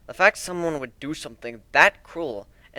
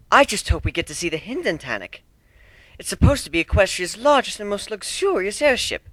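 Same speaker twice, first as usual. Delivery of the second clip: higher, dreamy lady's coo; cooing Rarity; 8 semitones higher than usual. Acted. Rarity dreaming aloud of the airship, cooing. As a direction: swoon over a luxury hotel airship. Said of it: "Rarity cooed".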